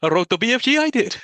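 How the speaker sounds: high, loud sing-song Welsh; mock Welsh accent. Podcaster joking as a Welsh author, mock Welsh accent. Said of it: "Roald Dahl Welsh".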